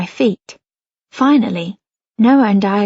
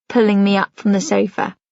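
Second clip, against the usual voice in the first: ordinary delivery, nothing marked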